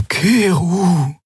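a lightly voiced old female creature, urgent; a hint of an urgent old companion; urgent plea. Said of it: "sagte Xea eindringlich".

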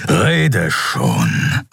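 menacing beast's growl. A narrator's reading. Keru orders the choking Kai to talk, growling. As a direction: growl the order at your captive. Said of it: "grollte Kero".

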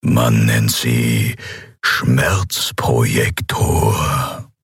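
low, very slow, measured menace; unperturbed emperor. A narrator's reading. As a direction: explain it unmoved, savouring each word. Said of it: "erklärte der Kaiser Andriel unbeirrt". (unbeirrter Kaiser, tief und gedehnt)

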